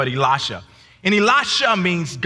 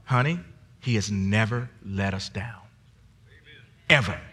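soft, low reassuring wife voice; a quiet, steady hint of his wife's calm; 9 semitones lower, 6 dB quieter than usual. Wife reassuring her husband that God never failed them, softly.

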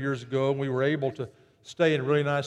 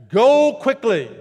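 high, loud, slow command; commanding call; 6 semitones higher, 6 dB louder, slower than usual. Acted. Angel sending the women off, loud and high.

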